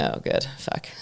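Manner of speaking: whispered under her breath; a hushed private groan of annoyance to herself; whispered aside. Retold or imitated. Aside to herself about drunk guy.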